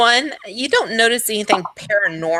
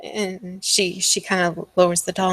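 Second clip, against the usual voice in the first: ordinary delivery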